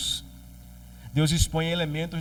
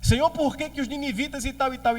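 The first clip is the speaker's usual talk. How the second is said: complaining Jonas; 8 semitones higher, faster than usual. Acted.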